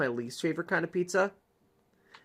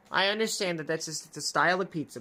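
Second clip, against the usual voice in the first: usual speaking voice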